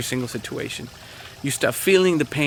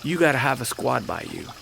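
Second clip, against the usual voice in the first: usual speaking voice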